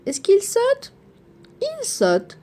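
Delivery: high, lively question then answer; high acted question. Shown as a demonstration. Teacher to the children, a high bright question.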